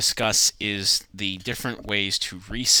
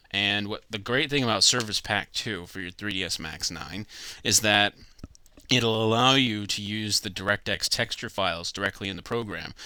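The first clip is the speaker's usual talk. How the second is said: ordinary delivery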